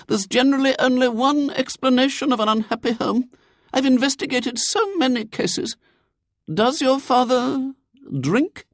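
very high, solemn lady's voice; earnest do-gooder lady. A narrator's reading. Lady investigates William's unhappy home, earnest and high.

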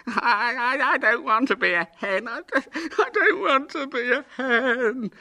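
put-on high, loud boyish wail; sobbing howl. A narrator's reading. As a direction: howl it out through sobs, drawing out the end. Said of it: "sobs turned into long howls".

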